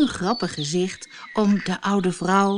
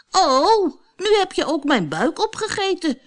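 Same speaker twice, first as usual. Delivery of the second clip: gingerbread man crying out; 9 semitones higher than usual. A narrator's reading. The gingerbread man crying out to the fox, high and frightened. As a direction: panic as he is eaten. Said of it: "riep de gemberkoekman".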